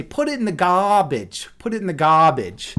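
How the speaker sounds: imitating a Boston teacher, garbage without the r; Boston accent. Streamer imitates an old teacher, light Boston accent. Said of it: "I can do, like, a Boston accent a little bit".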